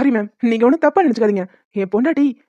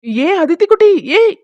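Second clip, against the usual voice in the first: father calling his daughter back, louder; 6 semitones higher, 4 dB louder, slower than usual. Real, not acted. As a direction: call her back across the yard. Calling the child as she leaves.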